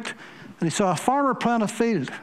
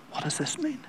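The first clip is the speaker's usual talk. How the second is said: hushed whisper, higher; whispered prayer; 6 semitones higher, 8 dB quieter than usual. Retold or imitated. Preacher voicing Campbell's whispered prayer to God. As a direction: breathe the question to God. Praying to himself, asking God.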